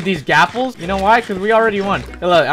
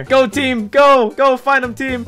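cheering shout; 7 semitones higher, slower than usual. Real, not acted. Cheering teammates on.

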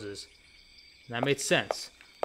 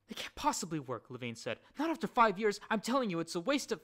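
higher, faster at the end; nervous objection, rushing; fretful Levine; 9 semitones higher than usual. A narrator's reading. Levine doubting the pumps to Thorn, higher and rushed.

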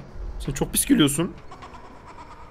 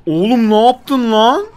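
startled outburst; 5 semitones higher, much slower than usual. Real, not acted. Game throws him across.

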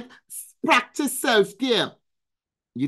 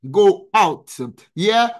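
ordinary talk

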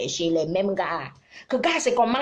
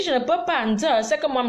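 ordinary talk

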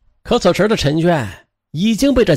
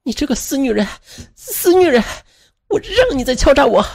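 high, strained cursing; enraged cursing acted out by a storyteller; furious outburst; 10 semitones higher than usual. A narrator's reading. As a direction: lash out in rage, pitch jumping way up. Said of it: "他就怒不可遏地上前狠狠地踹了".

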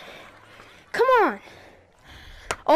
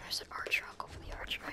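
unvoiced whisper; 22 dB quieter, faster than usual. Real, not acted. Gamer whispering while stalking the archer, unvoiced. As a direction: whisper it like sneaking up.

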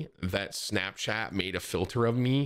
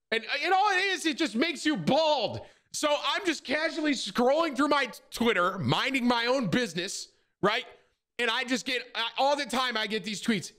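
worked-up rant; 13 semitones higher than usual. Real, not acted. Streamer venting to chat about the bald filter, high exasperated rant. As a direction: pitch it way up, fed up with the tweets.

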